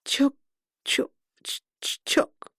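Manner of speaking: stammering through numb lips; fading to a broken whisper. A narrator's reading. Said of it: "Непослушными губами я пыталась выговорить".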